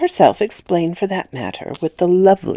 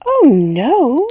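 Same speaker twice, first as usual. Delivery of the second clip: put-on high, loud little-girl denial, drawn out; a child's sweet but resentful no; high childish drawl, a touch resentful; 9 semitones higher, 8 dB louder than usual. A narrator's reading. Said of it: "resentful, though with a long sweetness in her little drawl".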